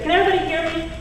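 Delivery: ordinary talk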